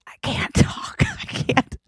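strained near-whisper, acting out sobbing; re-enacted tearful voice giving out; imitated choked-up voice. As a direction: force words through a throat closed by crying. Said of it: "first thing to go is my throat".

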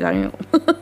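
deep, low mock-cool idol voice; playful impression of an idol posing on stage. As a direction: drop into a swaggering stage-performer voice. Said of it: "they're like".

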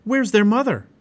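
high, loud, worried shout; shouted question. A narrator's reading. Boy worried about the mother bear, shouted question. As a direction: call it out in alarm. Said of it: "shouted Jack".

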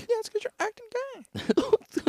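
very high, slow mock voice; mock falsetto. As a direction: croon it in a teasing falsetto.

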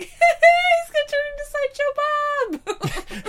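high, slow, giggling squeal; high laughing squeal. Retold or imitated. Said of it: "I'm just like".

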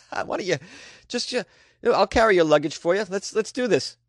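high, chummy mock-helpful voice; over-eager welcomer. U S greeter offering to carry luggage, mock over-eager.

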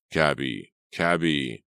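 bright call to a driver; cheerful cry. Acted. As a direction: hail the cab cheerfully. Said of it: "with a cheerful cry".

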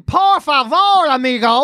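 high, slow, showy foreigner voice; hammed-up tourist Spanish. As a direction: lay on the fake Spanish. Retold tourist Spanish, put on.

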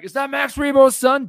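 high excited yell; mock scream. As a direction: shriek it at the screen. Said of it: "I was about to scream".

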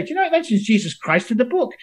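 high, excited teasing question; eagerly quizzing doubters about the book; eager challenge. Retold or imitated. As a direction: dangle the fact like bait. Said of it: "I'd say".

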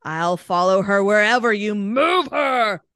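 loud ghostly shout; a hammed-up spooky threat; mock yell. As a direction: bellow it like a haunting spirit. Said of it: "it yelled at them".

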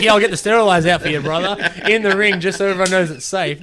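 loud, fast wrestling-promo voice; hype-man doctor. Retold or imitated. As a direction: play the doctor like a ring announcer hyping the crowd.

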